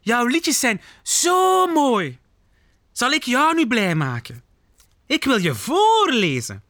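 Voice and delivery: high, gushing zebra voice; exclaiming. Acted. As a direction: gush adoringly, stretching the praise. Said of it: "roept ze uit". (hoog en dweperig enthousiast)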